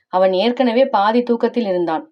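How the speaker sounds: ordinary delivery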